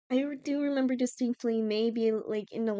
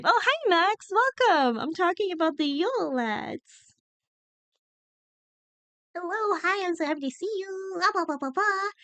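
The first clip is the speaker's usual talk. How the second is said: excited greeting; 7 semitones higher, 3 dB louder than usual. Real, not acted. Greeting a viewer arriving in chat.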